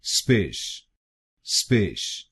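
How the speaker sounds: स्पिश said as a short 'spish'. Shown as a demonstration. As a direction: model the middle syllable स्पिश as 'spish'. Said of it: "एसपीआईसी हम बोलेंगे".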